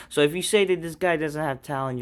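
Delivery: ordinary delivery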